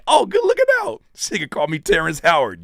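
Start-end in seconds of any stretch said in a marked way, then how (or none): 0.1-1.0 squeaky high mock thanks; mock grateful. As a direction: toss off a sarcastic high-pitched thank-you. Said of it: "Instead of saying".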